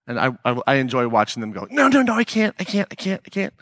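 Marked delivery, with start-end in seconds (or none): 1.7-3.5 high, rushed no-no-no protest, backing away from the money; flustered refusal. Retold or imitated. Practitioner turning down extra pay, flustered and higher-pitched. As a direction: mimic someone embarrassed to take more.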